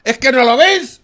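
heated shouting tirade; furious rant. Real, not acted. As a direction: rage at the government until told to calm down. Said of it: "Vale, cálmate".

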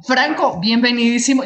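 host calling out a bright welcome to Franco; excited welcome. Real, not acted. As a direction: greet him with a big lift. Host welcomes guest on air.

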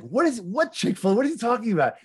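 high, mock-outraged confusion; baffled angry opponent. The annoyed opponent demands what Chick-fil-A has to do with it, hammed-up. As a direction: squeal it like a confused grouch.

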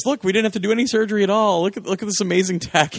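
high, fast sales pitch; excited presenter. Retold or imitated. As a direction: pitch it like a proud inventor. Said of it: "And he goes".

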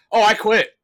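high, loud reckless quit; mock defiant quitting. Worker quitting his job for the World Cup, high and loud. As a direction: toss it off boldly. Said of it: "I go".

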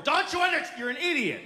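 mock parental tirade; exasperated dad. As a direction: lose patience and yell. Exasperated dad yelling at child.

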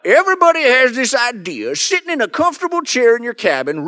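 put-on high-pitched old prospector coot; old hermit voice. Shown as a demonstration. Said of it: "Been working on that hermit voice".

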